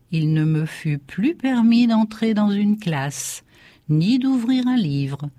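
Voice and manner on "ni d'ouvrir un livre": plain reading voice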